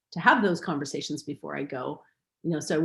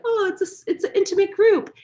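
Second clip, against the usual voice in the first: high, loud mock surprise; 14 semitones higher, 5 dB louder than usual. Telling the class about today's small group, high mock surprise. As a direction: act startled by the small turnout. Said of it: "I was like".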